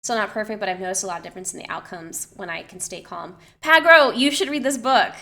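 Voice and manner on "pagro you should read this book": high, loud, eager call; calling out keenly. Real, not acted. Urging a chat member to read the book, calling out keenly. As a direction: call out the name high and loud, then urge.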